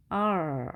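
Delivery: R as a long held "errr"; curled-tongue R. Shown as a demonstration. Teacher modelling the English R sound, R held long with curled tongue. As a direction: draw out R with the tongue curled back. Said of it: "살짝 구부려준다고 말씀드렸습니다".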